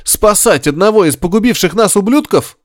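high, indignant disbelief; outraged. A narrator's reading. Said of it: "возмутился Горишвили".

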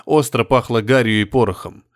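plain reading voice

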